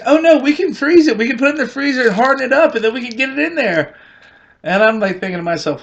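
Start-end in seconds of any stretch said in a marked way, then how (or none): 0.1-4.0 high, loud, rushing eager voice; eager girlfriend. Retold or imitated. Girlfriend insisting they can freeze it, high and eager. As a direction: sell the plan with giddy eagerness. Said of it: "And she goes".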